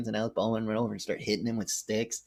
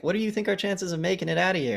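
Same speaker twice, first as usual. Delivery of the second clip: much higher, fast worried question; anxious Alec Baldwin; 10 semitones higher, faster than usual. Retold or imitated. Baldwin's character asks Hopkins about their chances, high and quick. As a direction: blurt the fear out quickly. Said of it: "Alec Baldwin's like".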